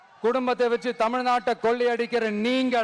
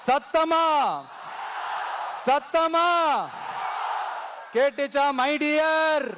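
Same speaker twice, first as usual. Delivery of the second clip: rousing crowd call; 2 semitones higher, much slower than usual. Real, not acted. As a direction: whip the crowd up. Urging crowd to answer louder.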